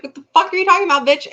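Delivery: fast, high, loud mock outburst; outraged husband. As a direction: imitate an indignant husband snapping back. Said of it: "And so the husband is like".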